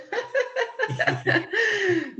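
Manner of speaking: real giggling laugh; laughter. Real, not acted. Presenter to nervous co-worker Mike, amused laughter. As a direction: laugh it off, trailing on.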